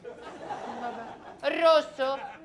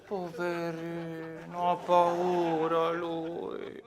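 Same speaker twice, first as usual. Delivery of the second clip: slow, lower mock sympathy; mocking pity; 7 semitones lower, much slower than usual. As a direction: coo at him like a baby. Mocking a scared caller.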